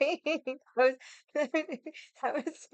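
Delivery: giggling through the words, barely able to speak; words lost in real helpless laughter; laughing uncontrollably. Real, not acted. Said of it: "I'm laughing uncontrollably like an idiot".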